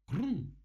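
громкий высокий «хрум»; резко и громко изобрази хруст; зловещий хруст. A narrator's reading. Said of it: "громкий и зловещий хрум". (громкий зловещий хрум)

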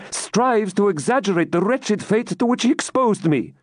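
high, impassioned heroine's reproach; Rebecca, accusing. Acted. Rebecca blaming the man who dragged her hither, accusing high voice.

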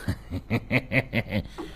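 quiet sinister laugh; soft evil cackle. A narrator's reading. Sorcerer cackles.

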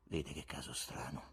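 low, soft and withdrawn; sadness. A narrator's reading. Withdrawn guest confiding in Ivan, sad and subdued. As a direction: speak low and quiet from inside sadness. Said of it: "si chiuse nella sua tristezza".

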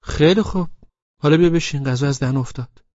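put-on high, loud girlfriend voice; girl's voice. A narrator's reading. Laleh scolding him for being late, high voice. Girl voiced inviting to eat.

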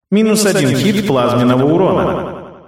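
detached game announcer; system message voice. A narrator's reading. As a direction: read it like a game interface.

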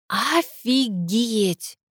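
very slow, drawn-out amazement; stunned. A narrator's reading. Sister finally reacts to the news, stunned. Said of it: "наконец проговорила Геля".

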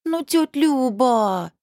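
slow, drawn-out whine on тётя Люба; whining. A narrator's reading. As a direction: whine like a begging child. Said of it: "заныла Анжелика".

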